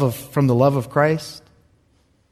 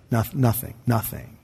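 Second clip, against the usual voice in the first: quieter, falling nothing; soft emphatic repeat; 4 semitones lower, 7 dB quieter than usual. Real, not acted. Preacher quietly assuring the congregation. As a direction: let it sink in softly.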